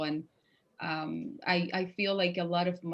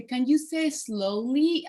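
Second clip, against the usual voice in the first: slow, higher and a bit louder, asking for patience; slow request; 5 semitones higher, 6 dB louder, slower than usual. Retold or imitated. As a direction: draw each word out carefully. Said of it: "I would say".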